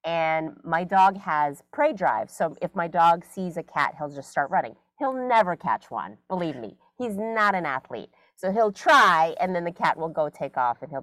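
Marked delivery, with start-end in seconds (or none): none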